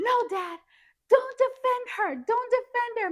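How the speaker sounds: high, loud imitation of an angry son; Mikey shouting. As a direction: yell in protest at dad, retold. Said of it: "he was shouting and it was echoing".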